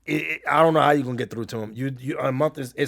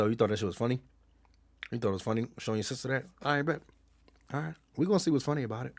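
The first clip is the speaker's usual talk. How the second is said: low, soft, hurried confrontation; quietly menacing dad; 3 semitones lower, 9 dB quieter, faster than usual. Retold or imitated. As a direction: voice a dad's understated threat, keeping it quiet. Dad confronting son in retold story.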